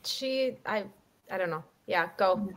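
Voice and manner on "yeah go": normal talking voice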